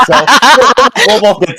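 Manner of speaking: giggling through it; laughing. Real, not acted. Laughing after teasing co-caster.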